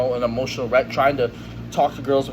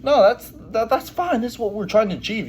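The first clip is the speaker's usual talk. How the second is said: higher, sing-song mocking voice; mocking higher voice for everyone who says it's fine; mock society voice; 8 semitones higher than usual. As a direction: parrot what society preaches in a silly high voice. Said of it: "society says".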